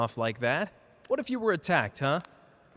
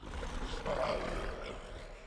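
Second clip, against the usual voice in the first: soft low chuckle; 11 semitones lower, 8 dB quieter than usual. Acted. Laughs at the other's bravado.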